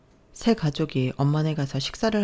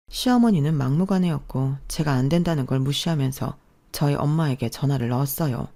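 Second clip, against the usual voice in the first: usual speaking voice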